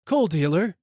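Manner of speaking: high, loud call from above; frozen rider calling out to the coal dealer; crying out. A narrator's reading. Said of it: "I cry".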